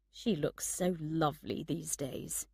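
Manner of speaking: fond thought kept to himself; talking to himself. A narrator's reading. Man admires his friend to himself, musing softly. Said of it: "he told himself".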